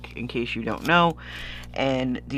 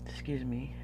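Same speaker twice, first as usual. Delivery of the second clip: stuffy nose, soft apology; sniffly and quiet; sniffling; 11 dB quieter than usual. Real, not acted. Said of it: "with the sniffling again".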